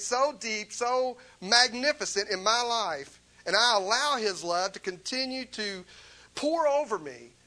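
a fervent sermon climax; preaching at full force. Real, not acted. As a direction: preach it with rising fervour.

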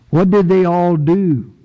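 usual speaking voice

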